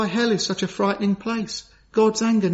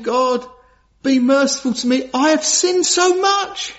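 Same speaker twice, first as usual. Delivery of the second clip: pleading prayer; 6 semitones higher, 3 dB louder than usual. Retold or imitated. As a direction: beg God for mercy, voice lifted. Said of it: "he's saying".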